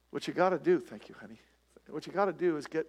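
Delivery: ordinary delivery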